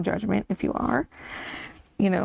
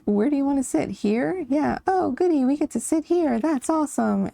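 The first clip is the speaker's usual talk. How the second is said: mock excited kids; 6 semitones higher than usual. Imitating excited kids getting seats.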